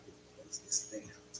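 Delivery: usual speaking voice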